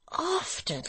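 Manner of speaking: Barnaby's high, startled cry; a sudden high cry of disbelief; cried out. Acted. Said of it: "cried Barnaby".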